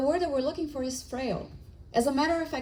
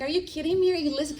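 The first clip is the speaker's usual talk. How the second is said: exasperated disbelief; 6 semitones higher, much faster than usual. Retold or imitated. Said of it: "I'm like".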